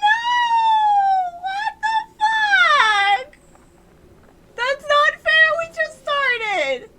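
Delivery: high, drawn-out wailing shout; anguished protest. Real, not acted. Player protesting a tragedy early in the game, high wailing shout. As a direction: wail loudly at the unfairness.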